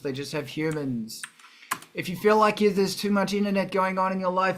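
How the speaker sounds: normal talking voice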